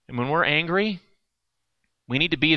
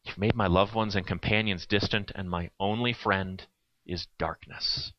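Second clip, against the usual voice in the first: much softer and lower, slowing at the end; quiet, slow reread; 7 semitones lower, 8 dB quieter than usual. Shown as a demonstration. Preacher rereads the psalm's final line to the congregation, hushed. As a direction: let the words sink into darkness. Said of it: "That's where it ends".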